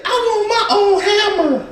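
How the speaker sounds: put-on very high, giggly child voice; a sweet kid asking for a hammer; little girl voice. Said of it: "She said".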